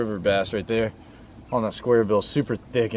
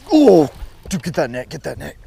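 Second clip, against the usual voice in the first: excited shout; 5 semitones higher, 7 dB louder than usual. Real, not acted. Fish on, calling for net.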